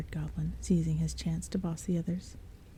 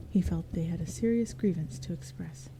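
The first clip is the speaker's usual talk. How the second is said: ordinary delivery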